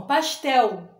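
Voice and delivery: "Pastel" with the final L clearly sounded. Shown as a demonstration. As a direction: stress the L at the end of "Pastel". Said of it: "Olha o som". (pronúncia marcada do L final em "Pastel")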